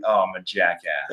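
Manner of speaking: a laughing self-put-down; laughing at himself. Retold or imitated. Realizing his own mistake, laughing at himself.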